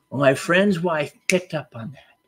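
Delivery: ordinary talk, nothing marked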